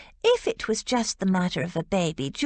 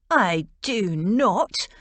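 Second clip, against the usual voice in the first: slow, louder, each word pressed down; emphatic denial; 4 dB louder, much slower than usual. A narrator's reading. George denying she likes the Duke, slow emphatic protest. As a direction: deny it firmly, word by word. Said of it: "George said emphatically".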